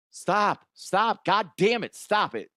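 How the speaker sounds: fed up, each command on its own; exasperated scolding. Pretend. Scolding a star player like a kid, exasperated.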